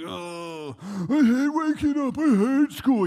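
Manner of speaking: put-on high, loud whiny kid; mock a grumpy boy's drawn-out wail; grumbling son. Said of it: "and he'd grumble".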